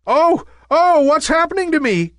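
very high, panicked little cloud; fearful cry. A narrator's reading. Nimbus feeling himself change, crying in fear. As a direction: cry out loud and high in alarm, then rush the question. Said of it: "cried Nimbus in fear".

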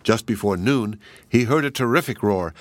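usual speaking voice

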